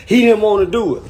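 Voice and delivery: ordinary talk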